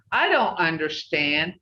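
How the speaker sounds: usual speaking voice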